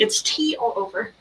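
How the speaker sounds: ordinary talk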